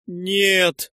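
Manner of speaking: loud, high cry of refusal; distraught denial. A narrator's reading. Wife shakes head harder, denying.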